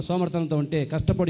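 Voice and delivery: ordinary delivery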